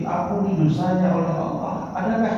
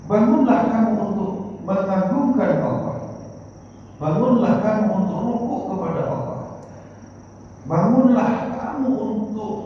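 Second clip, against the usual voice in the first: preaching at full force; 4 semitones higher than usual. Acted. Repeated preaching call to rise.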